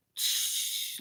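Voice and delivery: soft imitated static noise; static hiss. Shown as a demonstration. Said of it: "like static".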